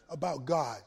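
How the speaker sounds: sharp gasp of awe before the words; awed gasp. Shown as a demonstration. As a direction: gasp as if struck by wonder. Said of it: "made you go, "Wow"?".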